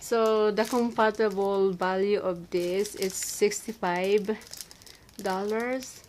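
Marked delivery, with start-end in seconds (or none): none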